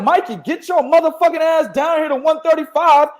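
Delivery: hammed-up fighter's taunt; mock callout. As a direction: call him out like a fighter. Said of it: "He was like".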